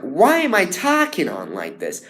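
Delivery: "talking" with an open vowel, close to taking; imitating other Billies. Student mimicking the Billies who blur talking. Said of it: "they say, like".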